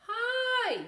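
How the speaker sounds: very high, loud chirp; squeaky bird hello. Acted. Bird puppet greeting the children, very high and loud.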